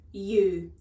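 loud headmistress bark; single out a pupil, loudly; barked command. Acted. Headmistress pointing at a tiny girl, loud and commanding.

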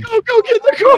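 high-pitched, frantic game call-out; urgent call. Pretend. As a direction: send your partner running, pitch shooting up. Urgent call to partner during robbery.

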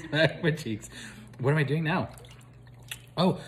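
ordinary talk, nothing marked

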